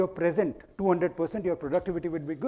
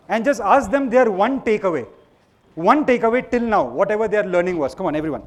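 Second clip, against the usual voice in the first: raised, high voice over the noise; calling out to crowd; 3 semitones higher, 8 dB louder than usual. Real, not acted. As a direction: shout instructions across the room.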